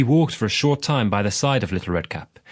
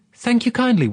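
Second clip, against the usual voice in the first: put-on light, higher little girl's reply; 13 semitones higher than usual. Little Redcap innocently answering the wolf, higher girlish voice. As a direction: answer sweetly like a trusting child.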